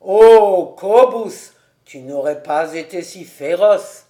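Han teasing Cobus, lightly mocking; a touch of mockery. A narrator's reading. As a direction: poke fun at his boast, gently. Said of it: "d'un ton un peu moqueur".

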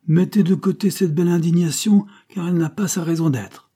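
a haughty uncle sneering at the duke; scornful marquis. A narrator's reading. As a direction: dismiss his outrage with contempt. Marquis scorns his indignation.

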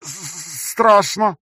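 high, stammering robotic voice; distorted robotic stutter. A narrator's reading. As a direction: stutter through a distorted mask. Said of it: "искажённым голосом, напоминающим роботизированный".

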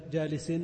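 جالس said jalisin, ending in -in; genitive tanwin. Shown as a demonstration. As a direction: sound the final -in on جالس. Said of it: "لأنها تقبل التنوين".

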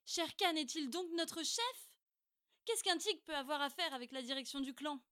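defiant challenge. Acted. Boy defies the council.

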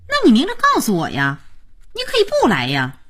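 mildly cross, aggrieved protest; a bit angry. A narrator's reading. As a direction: push back, put out that kindness backfired. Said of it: "也有点气".

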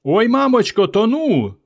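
high, panicky drowning wail; wailing for help. A narrator's reading. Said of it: "не вгавала Варка".

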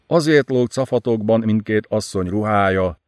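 usual speaking voice

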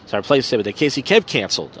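normal talking voice

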